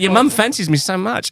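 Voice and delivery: high, loud imitated Australian accent; gleefully hammed-up Australian psychic. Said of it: "like, an Australian psychic".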